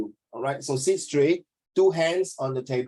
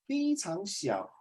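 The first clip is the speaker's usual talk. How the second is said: 非常小 said slow and much softer; tiny voice; 5 semitones higher, 7 dB quieter, slower than usual. Shown as a demonstration. Teacher demonstrating smallness, soft and slow. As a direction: shrink the voice on 非常小. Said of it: "you must bring out the moods".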